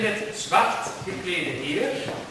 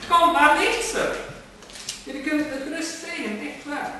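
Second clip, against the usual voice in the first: coaxing; 8 semitones higher than usual. Acted. Gentleman coaxing the plants in a hushed, eager voice.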